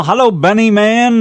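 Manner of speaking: loud, high, stretched-out hello; calling out greeting. Real, not acted. Host greeting Benny in the chat room, loud calling-out. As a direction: sing out a big radio hello. Calls greeting to arriving listener.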